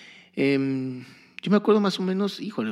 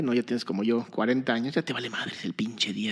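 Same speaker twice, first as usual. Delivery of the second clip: ordinary talk, nothing marked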